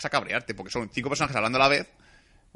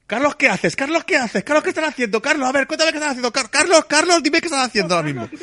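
much higher, louder, insistent mock nag; nagging terrorist on phone; 6 dB louder than usual. Terrorist hounding Carlos on the phone, mock hysterical nagging. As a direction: pester him over and over, shrill and pushy. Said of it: "lo que te pega el teléfono todo el tiempo".

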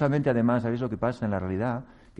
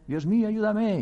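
mild plea; 8 semitones higher than usual. Acted. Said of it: "y es de noche".

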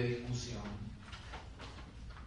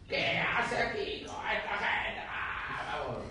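a hint of a scandalised Pharisee, whispered and slow; mock the outraged guest; indignant Pharisee; 4 dB quieter, slower than usual. Teacher voicing the Pharisees at the feast, mock indignant.

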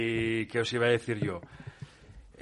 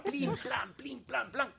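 slap sounds; 12 semitones higher, 6 dB quieter, much slower than usual. Retold or imitated. Imitating slaps with laughter.

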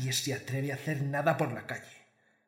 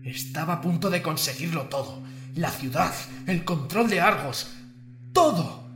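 rising fury up to the last word; snarling punk; 4 dB louder than usual. Acted. Boss ranting over lost power.

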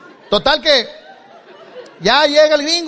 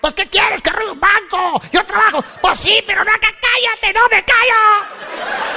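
much higher, rising to shouted yells; mock shouting match; 8 semitones higher, slower than usual. Husband and wife shouting each other down, mock yelling. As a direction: let the fight boil over into yelling.

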